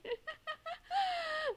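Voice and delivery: squeaky high laugh; high giggle. Real, not acted. Streamer laughs at own joke.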